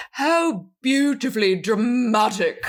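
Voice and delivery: slow, lofty theatrical delivery through a laugh; mock theatrical. Podcaster quoting Lord Byron, laughing mock theatrical. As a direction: play Lord Byron swooning at the storm. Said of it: "He looks out of the storm and goes".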